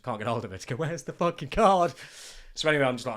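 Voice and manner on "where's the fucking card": loud, slow, fed-up; exasperated swearing. Retold or imitated. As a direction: bark it in frustration. Frustrated search for hidden card.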